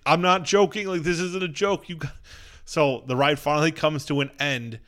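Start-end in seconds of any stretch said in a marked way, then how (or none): 0.1-2.2 higher-pitched mock pleading; raised, pleading retold yell; retold screaming. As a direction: insist urgently, reenacting the panic. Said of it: "me and him are both screaming".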